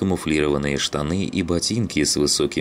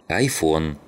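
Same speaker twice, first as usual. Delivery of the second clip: ordinary delivery, nothing marked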